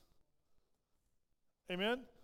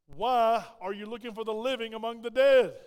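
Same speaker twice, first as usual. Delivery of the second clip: proclaimed angel question; 8 dB louder than usual. Retold or imitated. Quoting angels' question, preached.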